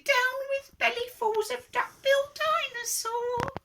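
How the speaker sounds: falsetto hooting chant; very high, sing-song protest. A narrator's reading. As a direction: hoot it like a little duck-billed dinosaur. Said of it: "and he hooted".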